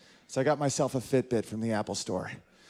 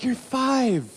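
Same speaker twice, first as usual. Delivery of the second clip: mock dad retort; 10 semitones higher, 3 dB louder than usual. Dad replies to kid.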